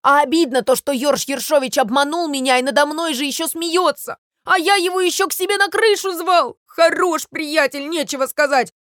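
wounded, complaining sparrow tirade; aggrieved scolding. Acted. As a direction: air your grievance against a false friend. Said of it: "бранился Воробей Воробеич".

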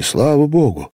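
high, rising exclamation of relief; heartfelt high cry of thanks; burst of joy. A narrator's reading. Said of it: "с несказанным порывом радости".

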